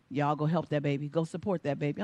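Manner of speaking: low, soft grandma voice; a lightly voiced grandmother; grandma urging the family to go support her; grandmama.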